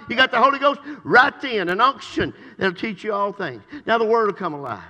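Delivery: loud, high, fervent preaching shout; full-force preaching. Acted. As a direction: preach it out with everything you have.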